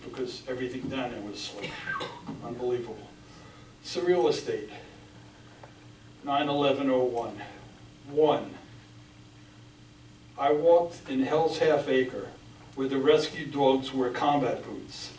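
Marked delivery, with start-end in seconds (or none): 3.8-15.1 measured, solemn poetry recital; grave spoken verse, firmly projected; poem reading. Acted. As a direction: read it out in deliberate phrases, pausing after each. Reads own poem aloud.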